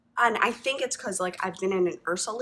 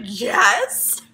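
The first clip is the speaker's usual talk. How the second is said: 1 eager yes; 9 dB louder than usual. Retold or imitated. Eager yes retold to shop assistant.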